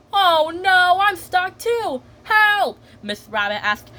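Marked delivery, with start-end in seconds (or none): 0.1-2.8 high, wailing cry for help; mock panic. Mommy Pig gets stuck beside Miss Rabbit, high panicked cry. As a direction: call out high as if trapped.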